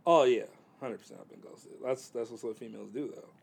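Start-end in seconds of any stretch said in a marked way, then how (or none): none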